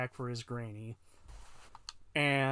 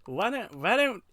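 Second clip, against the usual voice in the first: building, louder, pleading push; insistent; 7 semitones higher, 6 dB louder than usual. Real, not acted. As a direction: press harder each repeat. Repeats himself, pushing his idea.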